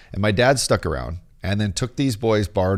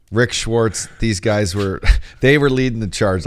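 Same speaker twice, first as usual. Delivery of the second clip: ordinary talk, nothing marked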